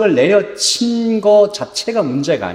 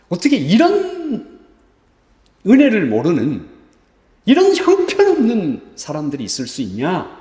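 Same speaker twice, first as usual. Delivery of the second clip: exasperated disbelief; 4 semitones higher than usual. Acted. Moses fed up with the ungrateful people, indignant high voice. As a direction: drag out the outrage, pitched up.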